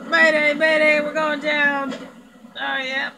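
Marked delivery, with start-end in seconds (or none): 0.1-1.9 loud mock distress call; mayday radio call. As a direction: pretend mayday over the radio. Plane going down.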